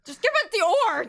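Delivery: loud exasperated yell; shouting. Real, not acted. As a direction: yell it at the table. Urging player loudly.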